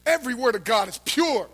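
normal talking voice

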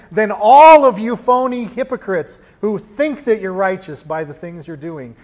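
loud burst of rebuke, then easing; scolding rebuke. Retold or imitated. Preacher as Jesus telling off the Pharisees, scolding rebuke. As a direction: lay into the phony hypocrites.

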